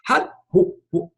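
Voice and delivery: high, halting voice of an emotional son; choked up. Retold or imitated. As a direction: catch in the throat, can't get the words out. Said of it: "he gets emotional".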